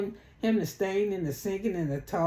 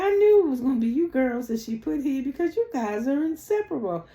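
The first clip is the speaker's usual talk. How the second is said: high, airy put-on voice; imitating Angela; 7 semitones higher than usual. Mimicking Angela teasing the girls, in a high voice. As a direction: lift the voice high and prissy. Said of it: "Angela said".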